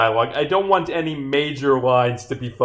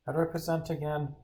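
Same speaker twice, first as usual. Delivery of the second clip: hushed sheepish aside; 11 dB quieter, faster than usual. Acted. Detective privately asking how to present, hushed aside. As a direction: mumble it fast under your breath.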